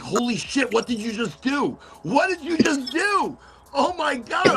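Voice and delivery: imitating shocked guy. Retelling the farm guy's horror to the hosts, mock shocked. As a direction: play the stunned farm guy, rising as it sinks in.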